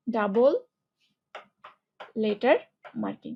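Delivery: word by word, long pauses between; measured dictation with big gaps; slow dictation. Shown as a demonstration. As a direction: dictate it in separate words. Dictating the rule while writing.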